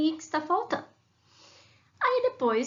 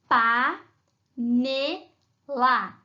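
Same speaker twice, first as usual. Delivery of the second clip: "Pá, nê, lá" one at a time with pauses; separate syllables; 4 dB louder, much slower than usual. Shown as a demonstration. Teacher asking which word they form, syllables spaced apart. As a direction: sound out "Pá, nê, lá" as isolated syllables. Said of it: "com essas três sílabas".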